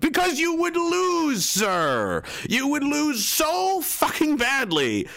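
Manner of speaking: high, drawn-out indignant cry; exasperated outburst. Acted. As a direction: wail it slowly, driving each word home. Ranting reply to quoted statement.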